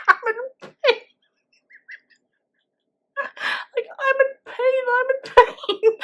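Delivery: mock pained wail. Retelling patient writhing in pain.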